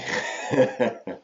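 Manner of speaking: light chuckle; a brief laugh to round off the remark; short laugh. Real, not acted. Teacher to class on choosing an easier word, light laugh.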